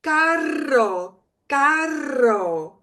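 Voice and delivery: Carro with a rolled double R; carro with a strong trill. Shown as a demonstration. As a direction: roll the RR in Carro. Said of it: "we should trill if we can".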